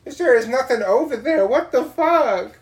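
loud shocked yell; shouting. Retold or imitated. Driver yelling at an empty road, shocked shout. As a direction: yell it out in disbelief.

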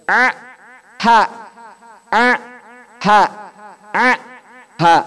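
alternating ain and ha from the mid throat. Shown as a demonstration. Teacher drilling ain and ha. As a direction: switch a and ha from the throat. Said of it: "ada huruf ain sama ha".

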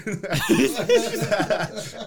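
high burst of laughter; loud laugh. Real, not acted. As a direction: crack up laughing. Laughing at friend's face.

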